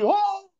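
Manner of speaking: sudden high yelp of alarm; retold cry. Preacher acts out the sister crying out at deliverance, a sharp high yelp. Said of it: "elle crie".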